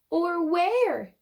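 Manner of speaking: sniffing. Acted. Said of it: "he sniffed".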